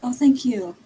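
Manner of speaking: ordinary talk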